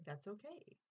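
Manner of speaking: soft, lightly voiced reassurance; Dickens/character. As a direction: gently let them off the hook. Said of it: "I could imagine him just saying".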